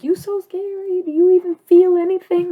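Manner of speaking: high, loud mock-accusing quote; mimicking everybody. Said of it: "everybody's like".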